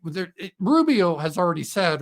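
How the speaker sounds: usual speaking voice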